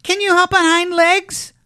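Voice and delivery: very high, loud rabbit challenge; high-pitched cheeky wild rabbit; furry rabbit. A narrator's reading. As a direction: quiz him in a squeaky high rabbit voice. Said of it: "asked the furry rabbit".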